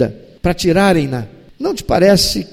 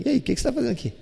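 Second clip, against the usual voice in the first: gentle; 4 semitones higher, 10 dB quieter, much faster than usual. Retold or imitated. God looks into his eyes.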